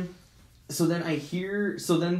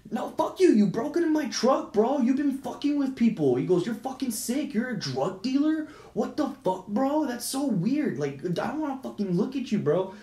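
mock yelling; 8 semitones higher than usual. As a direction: imitate an indignant man in a shouting match. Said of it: "yelling at each other".

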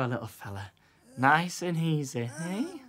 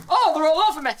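high, panicked squeals; panicking; 9 semitones higher, 3 dB louder than usual. Acted. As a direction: flail as bugs crawl on you. Fleas all over him.

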